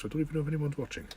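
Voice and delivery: usual speaking voice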